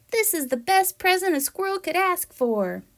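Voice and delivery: thrilled to see the gift; delighted squirrel. Acted. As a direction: squeal the name with joy. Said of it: "he says".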